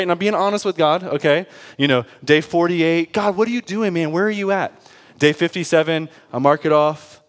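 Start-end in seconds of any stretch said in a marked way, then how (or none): 3.1-4.7 high-pitched, exasperated questioning; retold complaint, pitched up; frustrated demand. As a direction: demand an answer from God in mock exasperation. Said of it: "I'm getting frustrated".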